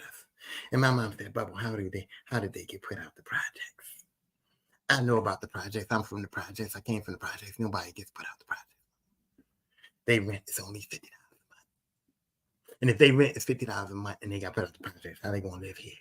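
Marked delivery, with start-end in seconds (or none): 1.3-8.7 acting out a low, near-whispered challenge; retold hushed questioning. Mom privately questioning dad, acted. 10.0-15.9 low, soft retold challenge; retold hushed questioning. Mom questioning dad, continued.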